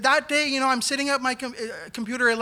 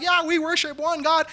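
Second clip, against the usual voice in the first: higher, sarcastic agreement; mock reply; 8 semitones higher, 4 dB louder than usual. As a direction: toss it off with light sarcasm. Mocking his own reply.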